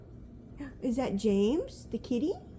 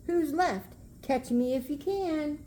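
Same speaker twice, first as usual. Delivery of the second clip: put-on taunting gingerbread baby; 5 semitones higher, slower than usual.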